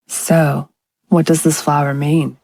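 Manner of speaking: low, lightly voiced husband, asking a second time; Brad asking again. A narrator's reading. Brad pressing his wife for the flower's meaning, low. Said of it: "Brad asked again".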